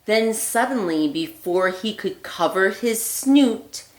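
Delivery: put-on lively read-aloud voice for children; storybook reading. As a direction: read it like a bedtime story for little ones. Reads picture book aloud.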